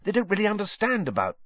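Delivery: high, stammering appeal; pleading schoolboy. A narrator's reading. Harry appealing to McGonagall, high and pleading. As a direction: plead the case, tripping at the start.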